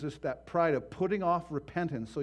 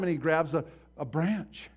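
ordinary delivery

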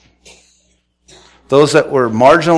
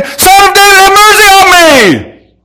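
all-out screamed cry for mercy, stretched long; full scream; 10 dB louder than usual. Acted. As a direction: scream the plea at the top of the voice. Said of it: "I'll scream".

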